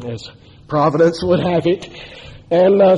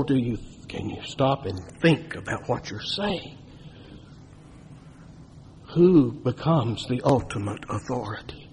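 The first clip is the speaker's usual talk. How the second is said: hushed challenge; 4 semitones lower, 10 dB quieter than usual. Real, not acted. Preacher soft challenge.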